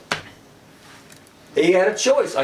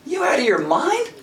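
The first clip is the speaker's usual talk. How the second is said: high, incredulous question swinging up at the end; playful pitched-up incredulity; mock disbelief; 8 semitones higher than usual. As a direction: balk at a crazy request. Acted disbelief at grabbing a rattler.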